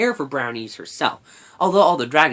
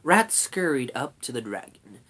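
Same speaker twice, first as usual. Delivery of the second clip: ordinary delivery, nothing marked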